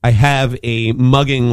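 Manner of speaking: ordinary talk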